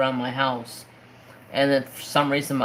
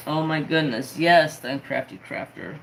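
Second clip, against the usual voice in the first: usual speaking voice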